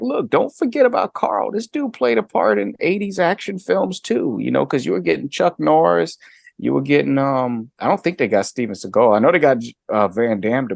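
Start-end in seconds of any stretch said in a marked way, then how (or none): 0.0-4.4 high-pitched, insistent retold plea; indignant appeal. Fan pleading with the film makers for Carl, indignant appeal. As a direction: make a mock protest on behalf of an overlooked actor.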